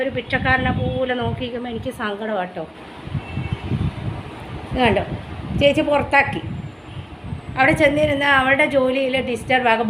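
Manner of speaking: ordinary delivery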